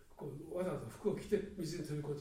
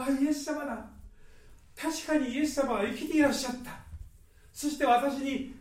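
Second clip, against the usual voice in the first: higher and louder, bursting with joy; joyful recognition; 8 semitones higher, 11 dB louder than usual. Acted. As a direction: cry out in delighted recognition. Mary recognizes risen Jesus. (喜びにあふれて高く叫ぶ)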